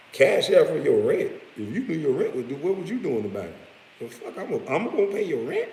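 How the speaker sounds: high-pitched, indignant disbelief; outraged questioning, voice leaping up; incredulous. Acted. Streamer mocks someone asking him for rent money, incredulous.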